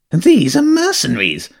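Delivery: high, stern hedgehog voice. Acted. Hedgehog warning the girl about rats, stern and high. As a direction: warn her off them, disapproving. Hegel warns Jenny.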